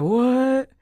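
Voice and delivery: high, loud startled gasp of a word; stunned what. Retold or imitated. Son seeing his glowing dead dad, shocked. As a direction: blurt it in shock.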